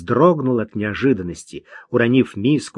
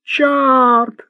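высокий громкий вопль; завопить во всё горло; 13 semitones higher, 4 dB louder than usual. A narrator's reading. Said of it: "завопил". (вопль бродяги)

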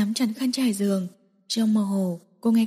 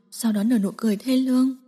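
plain reading voice

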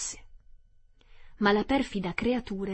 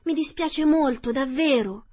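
high, loud scared apology; frightened apology; 6 semitones higher, 5 dB louder than usual. Acted. Dorothy apologizing to the melting witch, frightened. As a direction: blurt out sorry in fright. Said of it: "sinceramente impaurita".

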